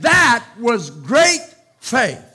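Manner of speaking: slow, loud proclamation; Jesus proclaiming. Acted. Jesus praising the centurion, slow loud declaration. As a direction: declare it slowly like a verdict.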